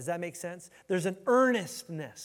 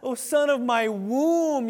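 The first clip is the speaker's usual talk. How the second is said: high, hammed-up wailing mother, drawn out slow; mock-cry like a mom laying on the guilt; guilt-tripping mom; 6 semitones higher, 8 dB louder, slower than usual. Preacher imitating a mom scolding her son in trouble, hammed-up wailing. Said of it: "one of those moms that when Lemuel got in trouble".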